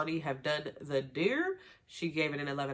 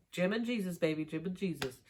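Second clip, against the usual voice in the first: normal talking voice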